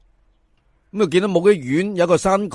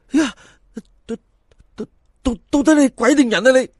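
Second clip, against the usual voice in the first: higher, stuttering then blurting the question; too scared to get the words out; frightened stammer; 8 semitones higher, faster than usual. Acted. Fan asking the corpse if it is ghost or man, stammering in fear.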